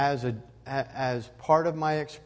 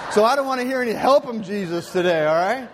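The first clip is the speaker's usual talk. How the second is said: high, loud mock protest with laughter around; exuberant, pitched-up preacher's joke; joking loud plea; 9 semitones higher, 9 dB louder, faster than usual. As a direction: call it out in playful warning. Joking warning after imitating congregation's yell.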